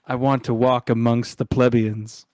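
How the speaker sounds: loud, hammed-up wealthy character; playful mock-lofty declaration; mock aristocrat. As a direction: proclaim it grandly, teasing the rich player. Teasing, voicing rich character's wish.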